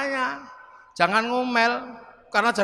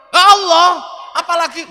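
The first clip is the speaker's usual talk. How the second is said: loud, higher, exasperated shout; exasperated Umar; 5 semitones higher, 10 dB louder than usual. Acted. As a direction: blast back with impatience. Umar answering the grave angels.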